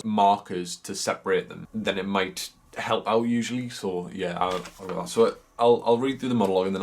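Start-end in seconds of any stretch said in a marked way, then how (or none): none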